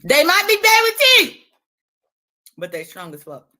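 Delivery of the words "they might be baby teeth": put-on high and loud, a squeaky baby-teeth voice; squeaky high mock voice. Vlogger mocking haters, then a high squeaky voice. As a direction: squeal it up high like a cartoon kid. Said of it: "repeats line in high voice".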